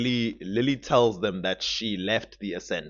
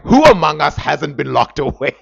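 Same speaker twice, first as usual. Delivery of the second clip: loud, raised rhetorical challenge; mock indignation; 4 semitones higher, 10 dB louder than usual. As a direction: declaim it like a hammed-up grievance. Grandly acting out Enzo's retort.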